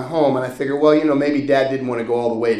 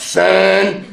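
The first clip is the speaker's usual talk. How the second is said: higher, louder impression of a father; dad's voice; 7 semitones higher, 7 dB louder than usual. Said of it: "that's how he talks".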